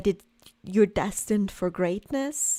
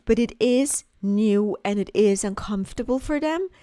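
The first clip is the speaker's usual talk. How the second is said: normal talking voice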